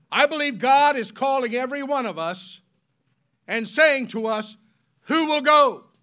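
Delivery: high, loud preaching; booming sermon call. Acted. Preacher voicing God calling the church, loud and high. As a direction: build to a ringing call.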